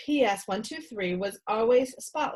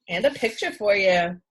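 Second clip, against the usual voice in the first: ordinary delivery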